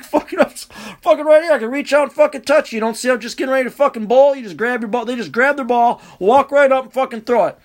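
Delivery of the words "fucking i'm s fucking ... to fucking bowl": high, rushed mock protest; exasperated complaint. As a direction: snap at someone who cut in front, pitched up. Acted complaint to oblivious bowler.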